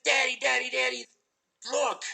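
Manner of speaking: put-on high, loud, eager little kid calling Daddy; a small boy bursting in to show his dad something; excited little son. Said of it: "very excited".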